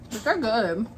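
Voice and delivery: normal talking voice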